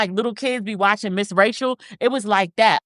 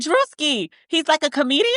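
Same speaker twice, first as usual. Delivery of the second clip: lightly mimicked, higher-pitched explanation; imitated stylist; 8 semitones higher than usual. Stylist explaining who the comedian is, lightly imitated higher. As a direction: raise your pitch to quote her.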